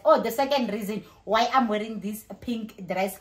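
normal talking voice

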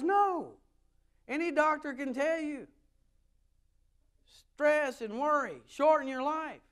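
high, loud rhetorical preaching; forceful preaching. Real, not acted. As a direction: drive the question and answer home loudly. Preaching scripture question and answer.

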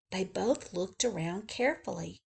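plain reading voice

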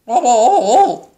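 loud, high gobbling wobble; turkey gobble-grumble. A narrator's reading. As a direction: gobble in mock frustration. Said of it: "grumbled Turkey".

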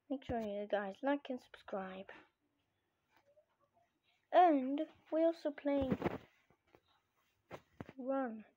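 usual speaking voice

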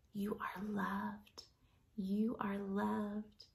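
slow, hushed whisper; whispered loving refrain; soft whisper. Acted. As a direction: breathe it slowly like a lullaby. Repeated tender refrain.

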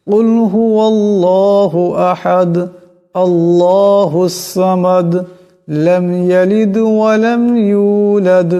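slow, melodic tajwid chant; Quran recitation. Acted. Teacher recites the sura slowly for students to learn. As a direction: lift each verse slowly in recitation. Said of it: "একবার পড়ে দিছি".

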